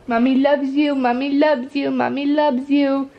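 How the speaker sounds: sing-song cooing to a pet. Real, not acted.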